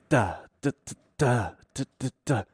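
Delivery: soft staccato "da" strum pattern; imitated strumming rhythm. Guitar teacher showing pupil a rhythm, imitated strumming. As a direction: mouth a guitar rhythm softly in short beats. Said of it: "just do this".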